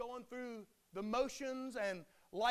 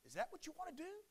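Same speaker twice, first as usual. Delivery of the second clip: ordinary talk, nothing marked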